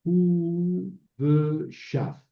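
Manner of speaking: "hu, ve, shaf" in separate syllables, "hu" long and high; syllable-by-syllable demo. Shown as a demonstration. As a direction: sound out "hu, ve, shaf" piece by piece. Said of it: "Por ejemplo".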